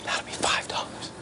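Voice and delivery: hushed voiceless whisper; leaning in to whisper. Retold or imitated. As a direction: lean in close and whisper the price. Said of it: "I've got to whisper".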